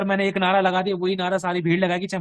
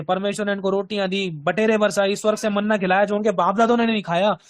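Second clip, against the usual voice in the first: ordinary talk, nothing marked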